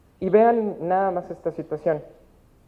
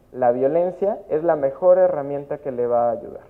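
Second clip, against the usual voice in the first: normal talking voice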